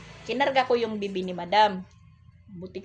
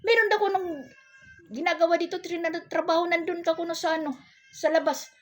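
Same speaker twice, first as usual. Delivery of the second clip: higher and louder imitation of his shouted summons; retold call; 10 semitones higher, 4 dB louder than usual. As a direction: call out his message, hamming it up. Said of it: "sabi niya sa akin". (ginagayang pagtawag, mas mataas at malakas)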